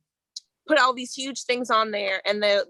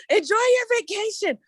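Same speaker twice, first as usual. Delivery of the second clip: coughing up water; 10 semitones higher than usual. Real, not acted. Straining after drink went down wrong pipe.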